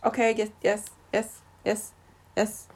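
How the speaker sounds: lightly voiced meerkat, fading to soft; a hint of a cartoon meerkat; meerkat impression. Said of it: "That was a meerkat impression".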